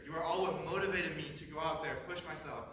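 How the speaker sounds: normal talking voice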